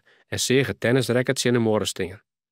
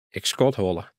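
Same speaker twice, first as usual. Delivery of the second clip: ordinary delivery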